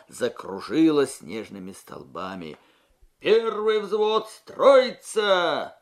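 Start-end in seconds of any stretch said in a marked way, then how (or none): 3.2-5.8 loud, high, slow drawn-out command; shouted order. A narrator's reading. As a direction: bark the order across the trench. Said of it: "прокричал".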